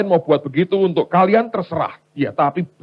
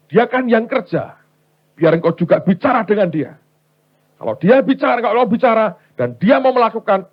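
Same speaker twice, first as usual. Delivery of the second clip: high, loud acted bargaining; insistent pleading; 7 semitones higher, 5 dB louder than usual. Wife asking God to speak to her husband, high insistent plea. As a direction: press the plea in a raised voice.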